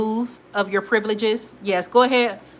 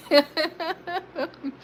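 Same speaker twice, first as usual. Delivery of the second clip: high, light chuckle; laughing; 8 semitones higher, 4 dB quieter than usual. Real, not acted. Host agreeing with a chat comment, laughing.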